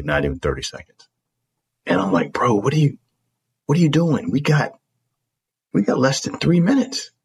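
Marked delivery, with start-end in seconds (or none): 2.3-7.1 rising, urgent high voice; urgent plea. Retold or imitated. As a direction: hurry him along, pressing. Urging lazy roommate to hurry.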